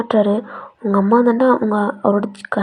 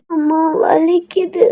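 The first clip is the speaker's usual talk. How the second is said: put-on slow, high, tearful little boy; a hurt toddler crying for his mother; child starting to cry; 7 semitones higher, 5 dB louder, much slower than usual. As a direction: whimper it out slowly, beginning to sob. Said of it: "அழுக ஆரம்பிக்கிறான்".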